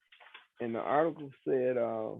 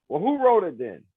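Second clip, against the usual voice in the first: louder, higher imitated yell of the old lawyer; retold yell; 5 semitones higher, 8 dB louder than usual. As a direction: bark it like an irritated old man. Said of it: "he was yelling, he was screaming".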